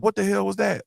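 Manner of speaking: high, sudden what-the-hell; startled outburst. Retold or imitated. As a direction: jump at a crash noise. Startled reaction to crash.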